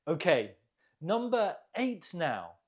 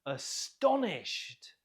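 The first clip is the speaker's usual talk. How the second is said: Astonished, slow, with STON raised and stretched; stress on second syllable; slower than usual. Shown as a demonstration. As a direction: punch STON in Astonished, slowly. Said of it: "Note the stress on the second syllable".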